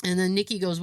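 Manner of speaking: normal talking voice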